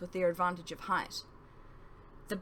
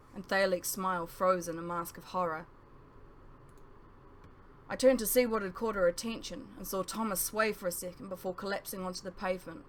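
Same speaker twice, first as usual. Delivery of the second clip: ordinary delivery, nothing marked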